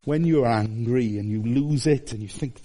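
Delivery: usual speaking voice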